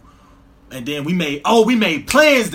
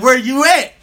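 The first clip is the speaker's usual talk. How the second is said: raised, louder retold demand; impatient demand; 7 semitones higher, 7 dB louder than usual. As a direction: act out a friend demanding an answer. Retelling woman's impatient text.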